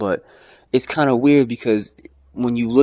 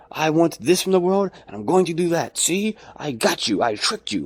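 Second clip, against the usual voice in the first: a hint of a smug dupe, pitched higher; smug naive voice; 6 semitones higher than usual. Mocking a self-deceived person, lightly higher and smug. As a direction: play the fool who thinks he won.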